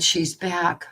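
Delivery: ordinary talk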